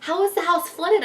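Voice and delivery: high, incredulous questioning; shocked what, rising sharply; retold freak-out. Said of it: "now I'm freaking out again".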